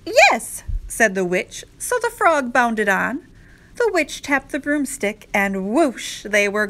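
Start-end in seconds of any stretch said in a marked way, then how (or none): 0.1-0.6 high, loud, eager yes; exclaimed yes. A narrator's reading. Witch welcomes the frog aboard, high loud exclamation. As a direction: call it out with the pitch shooting up.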